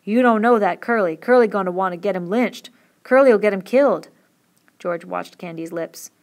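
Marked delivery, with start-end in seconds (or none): 0.0-4.1 urgent and much louder, pressing the point; Candy pushing hard to let Lenny escape; excited. A narrator's reading. Old Candy pleading with George, excited and loud. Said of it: "Candy said excitedly".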